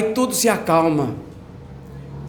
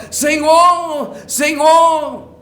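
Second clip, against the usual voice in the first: disciples crying out; 9 semitones higher, much slower than usual. Acted. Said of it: "os discípulos estavam percebendo o vendaval".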